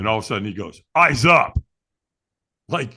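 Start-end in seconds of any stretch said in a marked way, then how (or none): 1.0-1.6 loud trainer's order; sharp command. Retold or imitated. Trainer correcting a firewalker looking down, sharp command. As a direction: call it out firmly and loud.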